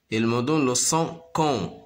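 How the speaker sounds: normal talking voice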